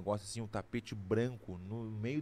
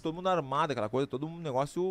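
ordinary delivery

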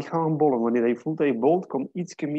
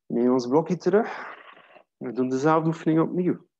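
ordinary delivery

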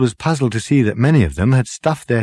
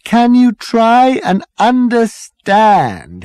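slow, high, loud pleading; exasperated appeal; 14 semitones higher, 6 dB louder, much slower than usual. A narrator's reading. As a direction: drag the words out to make them understand. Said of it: "appealed to the whole crowd".

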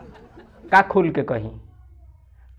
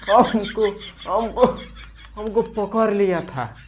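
indignant outburst; 6 semitones higher than usual. Acted. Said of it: "उल्टा हम ही पे चिल्ला रहे हो".

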